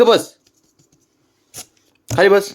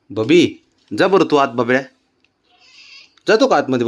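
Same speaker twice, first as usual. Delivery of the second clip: ordinary delivery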